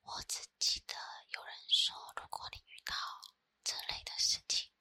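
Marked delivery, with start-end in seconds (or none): none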